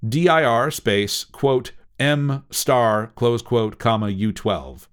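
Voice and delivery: slow, spelled-out command syntax; dictating. Shown as a demonstration. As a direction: read each symbol out slowly.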